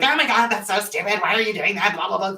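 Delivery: put-on scolding critic voice; hammed-up nagging inner critic. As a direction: scold in a mocking, altered voice. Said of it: "inner critic is gonna pop up".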